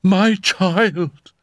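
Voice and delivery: high-pitched mother's outcry; emotional cry. A narrator's reading. Mother repeats her call to the child, high emotional cry. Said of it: "cried Madame X".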